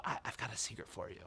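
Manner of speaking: very soft, confiding hush; telling a secret. Retold or imitated. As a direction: lean in with a secret for a little child. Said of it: "I'll lean in and I'll tell her".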